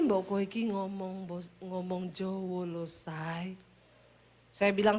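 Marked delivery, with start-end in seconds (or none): 0.0-3.5 much softer, lower, slow Javanese; soft Solo husband. Retold or imitated. Husband asking wife to speak Javanese, soft and fading. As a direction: coax gently, fading low. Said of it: "Berulang-ulang tiap hari".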